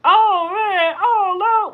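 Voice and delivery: loud, high cartoon whine; Cleveland Brown Junior. Mocking how he talks, loud high-pitched impression. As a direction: wail it in a silly high cartoon voice. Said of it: "Why he talk like that?".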